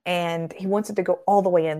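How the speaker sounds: ordinary talk, nothing marked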